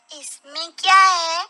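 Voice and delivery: put-on an eager boy eyeing the bag; curious child. Son asking father about the bag, curious child.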